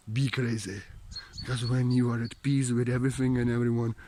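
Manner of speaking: put-on low, soft, slow guru voice in a comic accent; hushed, crazy-guru benediction; mock healing blessing. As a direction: bless them softly and slowly, sinking low.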